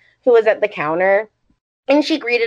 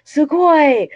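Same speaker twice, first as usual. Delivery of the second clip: lightly gushing, pitched higher; retold amazement; 6 semitones higher, 4 dB louder than usual. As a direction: mimic his impressed exclamation. Retold gushing over the zine.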